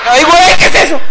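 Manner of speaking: high loud scared cry; terrified yell; frightened shout. Real, not acted. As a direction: panic at the thing. Sudden scare in game.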